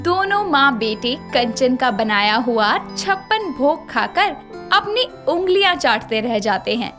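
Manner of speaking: usual speaking voice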